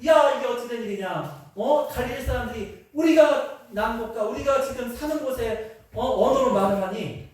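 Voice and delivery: high-pitched, amazed outcry; astonished crowd. Acted. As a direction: voice the crowd's amazement in a preacher's retelling. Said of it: "다 놀래요".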